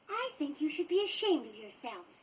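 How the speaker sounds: a lightly voiced young girl telling the boys off; Wendy scolding. A narrator's reading. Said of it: "she said".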